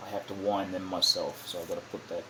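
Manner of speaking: ordinary delivery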